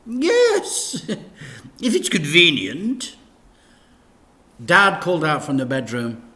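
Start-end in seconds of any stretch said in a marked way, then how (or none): 0.1-3.2 high, slow laughing vicar; high giggling vicar; giggly vicar. Acted. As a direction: chuckle it out sweetly.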